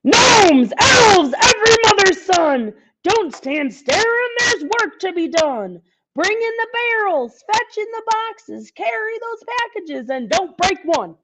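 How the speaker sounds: high, loud Santa shouting orders. Acted. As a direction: bellow to the whole workshop crew. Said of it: "his voice got louder".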